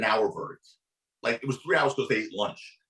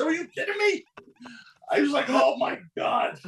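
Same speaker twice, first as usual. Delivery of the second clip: mock outrage; 13 semitones higher than usual. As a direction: stretch out the disbelief. Outraged disbelief retold.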